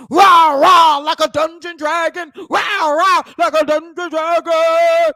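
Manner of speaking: roaring dungeon dragon, loud and mocking. YouTuber mocking a rapper's stage entrance, roaring impression. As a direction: shout a hammed-up monster roar. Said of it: "here comes [name]".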